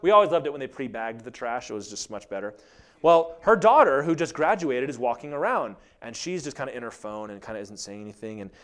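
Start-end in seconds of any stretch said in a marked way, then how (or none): none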